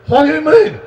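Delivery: high, loud incredulous outburst; shocked disbelief. Retold or imitated. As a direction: blurt it out in mock shock. Retold listeners shocked by the claim.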